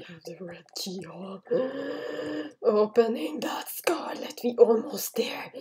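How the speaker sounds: hushed, voiceless whisper. Real, not acted. Player sneaking out with the red key, whispering. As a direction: whisper as you sneak along.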